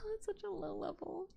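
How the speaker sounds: amused laugh mid-phrase. Real, not acted. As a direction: giggle it out in disbelief. Laughing at the insult.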